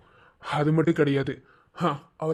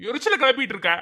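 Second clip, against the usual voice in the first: high, raised irritated voice; irritated scolding; 8 semitones higher than usual. Acted. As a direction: scold her in exasperation. Irritated husband scolds wife. (எரிச்சலான திட்டல்)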